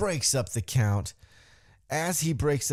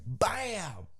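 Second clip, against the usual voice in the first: drawn-out mock impact cry, higher and softer; impact exclamation; 5 semitones higher, 6 dB quieter than usual. Fan retelling a super kick, exclaimed impact. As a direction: sound out the hit with a high "bam".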